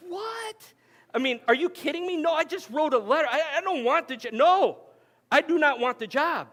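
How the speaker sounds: high, loud, incredulous protest; shocked disbelief. Retold or imitated. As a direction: blurt it out, stunned at the offer. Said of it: "I'm like".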